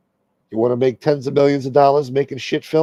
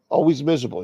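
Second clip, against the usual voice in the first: usual speaking voice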